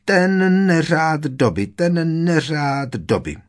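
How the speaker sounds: high, slow drawn-out wail; high-pitched keening elf lament. A narrator's reading. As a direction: howl it in self-punishment, stretching the words. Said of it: "kvílel přitom".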